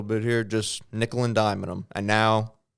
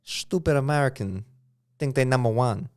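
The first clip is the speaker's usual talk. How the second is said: put-on Chinese accent; 4 semitones higher than usual. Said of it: "We're not bad at Chinese accents".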